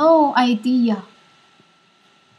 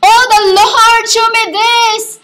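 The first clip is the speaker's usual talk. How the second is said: higher, louder mock plea to the Lord; false prophet mimicked, pitched up and loud; 8 semitones higher, 5 dB louder than usual. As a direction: ham up the fake prophet's cry. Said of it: "They would say to them".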